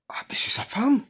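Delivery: retold gossip whispered behind someone's back. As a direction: hiss it like a nosy onlooker. Onlookers gossiping, retold scene.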